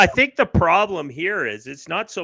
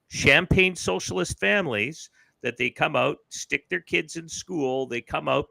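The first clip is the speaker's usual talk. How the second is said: ordinary delivery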